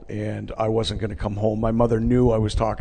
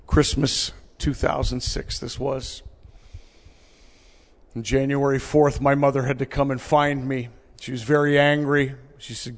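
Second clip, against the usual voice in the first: ordinary talk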